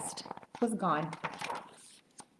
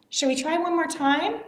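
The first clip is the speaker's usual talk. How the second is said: ordinary delivery, nothing marked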